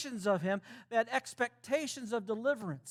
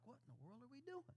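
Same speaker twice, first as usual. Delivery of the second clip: whisper; 25 dB quieter, faster than usual. Acted. Confused disciples following along.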